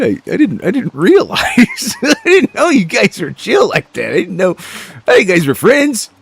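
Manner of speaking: high-pitched mock remorse through laughter; laughing apology. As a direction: apologize while cracking up.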